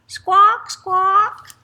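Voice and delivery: high, loud rising squawks; bird squawks. A narrator's reading. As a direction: screech twice like a parrot. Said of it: "squawked the toucan".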